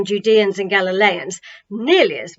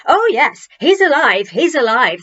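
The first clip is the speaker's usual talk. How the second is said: louder, bright and eager; joyful chorus; 3 semitones higher, 5 dB louder than usual. Acted. Crowd chorusing their favourite phrase, elated. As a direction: chant it back happily. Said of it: "they chorused".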